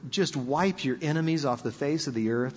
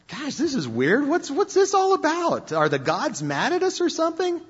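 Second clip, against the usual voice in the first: higher, puzzled sailors' voice; worried crew asking what's going on; nervous sailors; 10 semitones higher than usual. Retold or imitated. Sailors in the storm wondering aloud, higher and puzzled. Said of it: "the sailors are going like".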